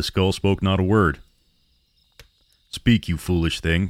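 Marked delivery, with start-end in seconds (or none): none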